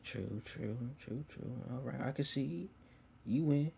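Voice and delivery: soft, subdued concession; quiet concession. Retold or imitated. Her conceding the point to the speaker, quietly. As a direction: murmur it as a reluctant admission.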